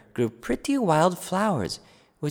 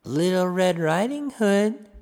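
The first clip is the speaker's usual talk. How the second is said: put-on wolf faking the girl; 4 semitones higher than usual. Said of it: "said the wicked wolf".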